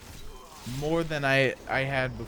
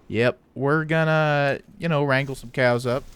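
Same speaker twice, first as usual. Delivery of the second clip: put-on Wyoming cowboy accent; 4 dB louder than usual. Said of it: "your Wyoming cowboy accent, where it's like".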